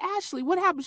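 puzzled. Retold or imitated. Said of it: "I'm like".

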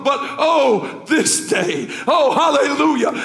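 high, loud, building sermon cry; full-force preaching. Acted. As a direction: preach it up to a shouted climax.

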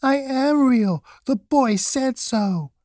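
high, slow, upset protest; near-tearful little rabbit. A narrator's reading. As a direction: plead slowly in a high small voice. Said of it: "he nearly began to cry".

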